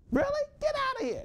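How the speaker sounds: very high, loud disbelief; high-pitched mock disbelief at a revelation; incredulous squeal. Incredulous reply in dialogue.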